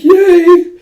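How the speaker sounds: very high, loud cheering; excited cheer. Retold or imitated. Friends offered a bath, cheering high and loud. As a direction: squeal it out in delight.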